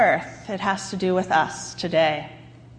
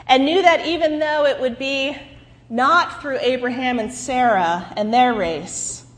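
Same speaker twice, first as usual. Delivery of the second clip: ordinary talk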